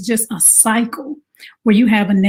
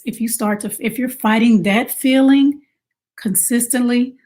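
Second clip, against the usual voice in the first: usual speaking voice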